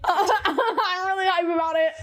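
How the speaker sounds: laughing, slowed, excited. Real, not acted. Fan laughing about the next chapter.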